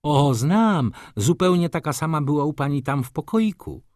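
put-on high, eager child's voice; little girl. A narrator's reading. Helunia answering the judge about the doll, childlike. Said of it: "spytał sędzia Heluni".

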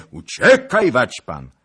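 high, loud cry to flee; urgent shout. A narrator's reading. Rider urges Zagloba to run, urgent shout. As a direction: shout a desperate warning.

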